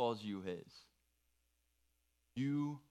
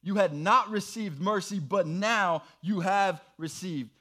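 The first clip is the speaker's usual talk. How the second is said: raised, louder proclaiming read; scripture reading; 6 semitones higher, 6 dB louder than usual. Acted. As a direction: proclaim the verse to the room. Group rereads the passage together.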